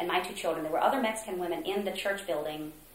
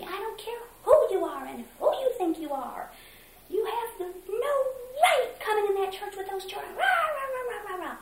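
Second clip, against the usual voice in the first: much higher, shrill-pitched mocking rant; mock yelling; 3 dB louder than usual. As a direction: imitate the director yelling, trailing into rah rah. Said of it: "he just started yelling at me".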